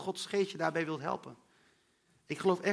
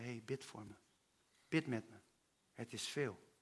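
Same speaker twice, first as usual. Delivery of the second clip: much softer, lower asking for prayer; soft plea; 8 semitones lower, 13 dB quieter than usual. Retold or imitated. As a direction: barely say it, vulnerable. Iemand vraagt om gebed. (zacht en laag vragend om gebed)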